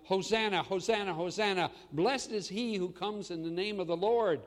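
loud, ringing cry of praise; loud joyful acclamation; crowd crying out. Retold or imitated. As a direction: call it out like a whole crowd. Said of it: "the crowds were all crying out".

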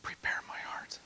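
soft, hushed group-prompt whisper. Shown as a demonstration. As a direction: whisper it for them to repeat. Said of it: "you ready to whisper that".

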